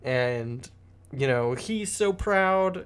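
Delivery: a light lift of joy in the pitch; delighted Ophelia. Retold or imitated. Ophelia tells of her proud father, lightly high and delighted. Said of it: "Ophelia's like".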